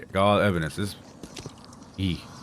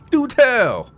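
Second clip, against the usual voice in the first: mock high voice; 4 dB louder than usual. Mocking the fortune teller.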